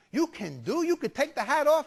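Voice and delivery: amazed Italian grandma, rising in pitch and volume; Italian grandmother accent. Retold or imitated. Grandmother double-checks with photographer, mounting amazement, Italian accent. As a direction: build excitement in Italian-accented English as it sinks in. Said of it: "nice little old Italian lady".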